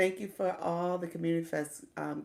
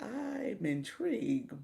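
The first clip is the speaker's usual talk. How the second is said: dry mock intrigue; 3 semitones higher, much slower than usual. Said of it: "I was like".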